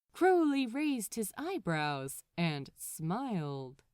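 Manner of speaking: usual speaking voice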